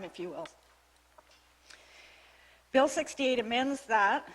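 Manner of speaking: ordinary delivery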